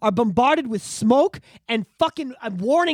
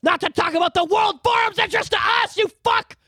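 angry shout; 9 semitones higher than usual. Real, not acted. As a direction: shout the insult at him. Ranting at the prime minister.

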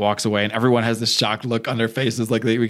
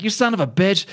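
acted fury; 7 semitones higher than usual. Wife curses man who punched husband.

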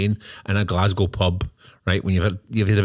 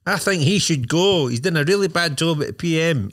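higher, dim voter voice; higher-pitched mock interviewee; mock man in the street; 8 semitones higher than usual. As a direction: play the clueless voter on the street.

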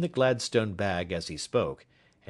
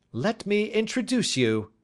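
much higher triumphant shout; 12 semitones higher, 4 dB louder than usual. A narrator's reading. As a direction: announce it with a flourish. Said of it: "he shouted".

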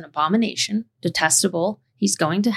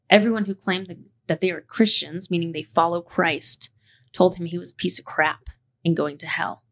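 ordinary talk

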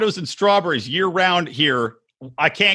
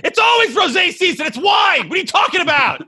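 mock furious shout; 13 semitones higher than usual. Ranting at the wine shop owner, hammed-up shouting. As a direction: blow up at him in mock fury. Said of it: "I was like".

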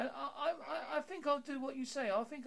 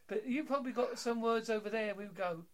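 ordinary delivery

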